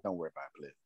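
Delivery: low, hushed mobster reassurance; mob tone. Retold or imitated. Retelling Ken calming him when upset, mob tone. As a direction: keep it very quiet, like a mob boss. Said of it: "that's that mob tone".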